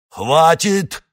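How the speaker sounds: sharp loud cutting-off cry; curt shout. Acted. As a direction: snap it out to silence them. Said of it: "отрезал Равин".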